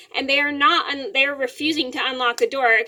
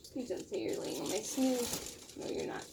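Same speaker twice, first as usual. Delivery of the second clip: murmur to cat; 16 dB quieter than usual. Real, not acted.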